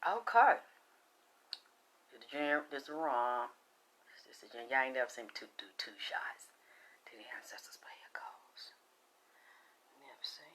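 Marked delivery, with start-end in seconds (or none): 2.1-5.5 hushed. Real, not acted. Hushed while pouring shots. 5.6-8.7 reverent whisper to ancestors. Real, not acted.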